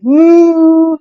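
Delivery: loud, long moo; big sustained cow imitation. A narrator's reading. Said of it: "the cow said".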